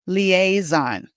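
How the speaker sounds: "Liaison" as lee-AY-zon; "Liaison" with a clear long A in the middle; middle rhymes with play. Shown as a demonstration. Coach showing a French word in English, middle A. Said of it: "That middle part is A".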